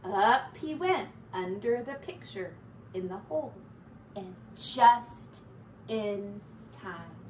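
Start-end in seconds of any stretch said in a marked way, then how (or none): none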